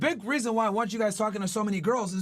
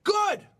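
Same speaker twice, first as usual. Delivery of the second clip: one high, punched GOOD; emphatic approval; 7 semitones higher, 4 dB louder than usual. Real, not acted. Coach sums up why her confusion helps, emphatic. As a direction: land GOOD high and hard, dropping at the end.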